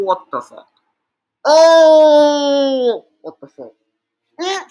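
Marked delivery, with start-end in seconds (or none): 1.5-3.1 long, loud, higher "oh"; drawn-out loud cry of exasperation; despairing wail. Real, not acted. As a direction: wail it out in dismay. Despairing at the slap.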